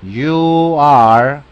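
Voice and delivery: careful full "you are" model. Shown as a demonstration. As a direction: say "you are" clearly as two words. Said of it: "la forma larga".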